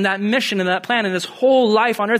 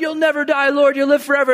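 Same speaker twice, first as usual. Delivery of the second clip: high, louder mock disciple voice; protesting disciple; 9 semitones higher, 5 dB louder, faster than usual. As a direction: insist in a high, over-earnest voice. Acting Peter's naive protest.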